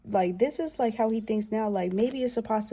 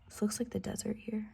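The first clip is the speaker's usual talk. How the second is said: whisper; 9 dB quieter, faster than usual. Real, not acted. Aside remark over the cards.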